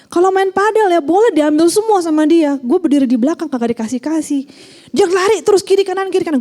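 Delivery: high, loud aggrieved rant about a teammate; venting complaint. Retold or imitated. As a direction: whine it like a fed-up padel partner. Complaining friend venting about padel. (keluhan kesal yang tinggi dan keras)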